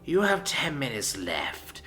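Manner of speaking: low slow whisper, softly ominous; calm menace. A narrator's reading. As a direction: calmly deliver a deadly order. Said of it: "he said calmly".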